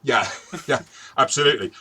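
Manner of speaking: laughing yes, loud and bright in agreement. Real, not acted. Laughing along as the co-host jokes about running away.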